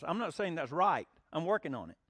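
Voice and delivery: ordinary talk, nothing marked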